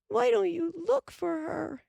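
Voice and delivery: high, frantic plea; frantic cry. A narrator's reading. Carrie cries at Laura to search, frantic. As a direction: near tears. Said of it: "Carrie cried".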